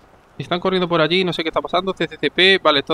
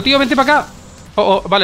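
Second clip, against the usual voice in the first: ordinary talk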